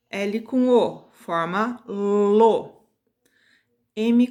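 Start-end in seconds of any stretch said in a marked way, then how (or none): 0.1-2.9 "forma lo" arrastado, mais alto; sílaba bem lenta. Shown as a demonstration. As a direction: alonga devagar cada sílaba. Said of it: "a gente vai falar as sílabas em voz alta". (professora estica "lo" bem devagar)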